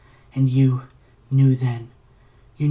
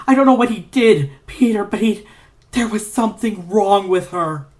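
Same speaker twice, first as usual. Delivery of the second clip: high, loud, panicked telling; terrified; 6 semitones higher, 5 dB louder than usual. Acted. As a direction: voice climbing with terror. Terrified recounting, voice rising.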